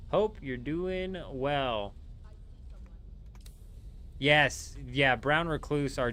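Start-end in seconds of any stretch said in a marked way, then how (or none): 0.1-1.9 slow, stretched-out repeat; drawn-out well-wish. Real, not acted. Streamer repeats a well-wish to new sub, drawn-out. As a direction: stretch each word out slowly.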